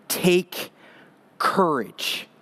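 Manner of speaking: quiet, gentle take courage; soft tender command. Retold or imitated. Jesus speaking to Paul, soft and tender. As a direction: say Take courage softly, as comfort. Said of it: "He said this".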